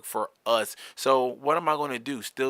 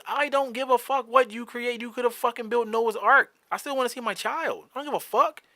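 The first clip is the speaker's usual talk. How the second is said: high-pitched, exasperated comeback; retold defiant retort; 11 semitones higher than usual. Arguing parent insisting on seeing the child, defiant. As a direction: throw it back, high and fed up.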